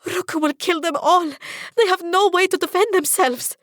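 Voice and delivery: high, loud desperate cry; frantic cry. Acted. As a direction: cry it out in terror for them. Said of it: "I cry".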